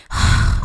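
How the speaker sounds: eye-rolling sigh; imitated exasperated sigh. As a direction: huff out a dismissive sigh, mimicking him. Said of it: "this is the guy's reaction. He, he rolls his eyes".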